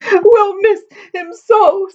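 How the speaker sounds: slow, very high sobbing wail; crying. A narrator's reading. Daughter mourns the son she imagines, crying. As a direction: bawl it in drawn-out acted sobs. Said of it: "sounding like I'm crying".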